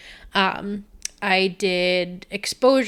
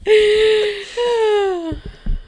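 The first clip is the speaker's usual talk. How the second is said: laughing; 10 semitones higher than usual. Real, not acted. Laughing fit.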